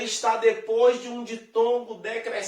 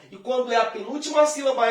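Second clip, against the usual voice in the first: ordinary talk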